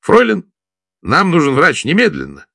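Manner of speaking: put-on high, loud drunken call down the phone; tipsy shouting into phone. A narrator's reading. Tipsy Gerard yelling into the phone for a doctor, loud and high. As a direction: yell it tipsily at the operator. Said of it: "пьяноватым голосом кричал в трубку".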